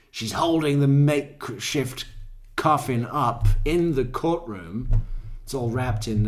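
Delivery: ordinary talk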